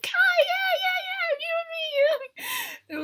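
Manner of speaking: very high, laughing, squealing fan voice; imitating excited fans. Fans at the fence gushing high and giddy about Kai. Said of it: "And they'd be like".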